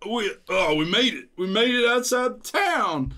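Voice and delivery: high, loud wobbling cheer; woozy triumphant hero. Acted. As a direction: cry out in dazed triumph, drawing out the last words. Wobbling hero celebrates getting out of town.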